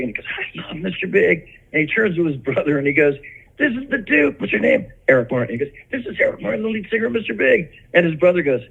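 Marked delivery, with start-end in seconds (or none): 0.3-1.5 high, drawn-out fan enthusiasm; excited fan. Retold or imitated. Justin hearing his band's name, imitated excited fan. Retells a fan's delighted reaction. 3.6-4.9 very high, eager imitation; excited introduction. Justin introducing him to his brother, imitated excitement. 5.9-7.7 very high, eager imitated announcement; excited introduction. Justin announcing the singer to his brother, imitated excitement.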